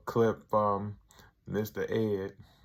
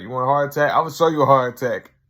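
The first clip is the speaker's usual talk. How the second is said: loud, higher retold threat; mock threat; 3 semitones higher, 10 dB louder, faster than usual. Rob threatening Tyrone at the intervention, loud mock anger. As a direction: threaten him, hammed up. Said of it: "He's like".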